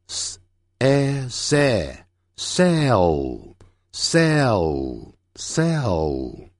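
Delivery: s, a, se blended slowly into sell; very slow, stretched sell with a held final L; sounding out. Shown as a demonstration. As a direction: build sell sound by sound, then repeat it. Teacher spells out word by sounds.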